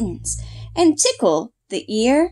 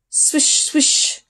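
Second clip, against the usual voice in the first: breathy unvoiced swish; whispered swish; 4 semitones higher than usual. A narrator's reading. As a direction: brush the words out on air. Swishing sound.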